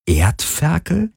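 slow, high questioning echo; drawn-out puzzled repeat, rising steeply; mouthed incredulously. A narrator's reading. Captain silently echoing the insult, incredulous. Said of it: "wiederholten ihre Lippen stumm".

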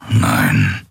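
very low grumbled reply; gruff grumble. A narrator's reading. Skrier denies knowing the girl, deep grumble. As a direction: drop the pitch deep and grumble. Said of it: "brummte er".

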